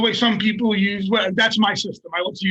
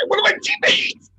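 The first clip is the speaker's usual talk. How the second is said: usual speaking voice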